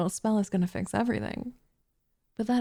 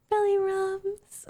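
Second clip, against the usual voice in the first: put-on teasing baby talk; 10 semitones higher, much slower than usual. Teasing cat with belly rubs.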